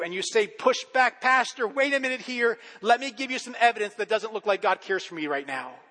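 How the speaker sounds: loud, high, driving sermon voice; preaching at full force. Acted.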